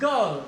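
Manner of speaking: गर्ल said with no r sound; गर्ल, the r dropped before l; girl without r. Shown as a demonstration. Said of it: "आर के बाद ले". (गर्ल बिना र के)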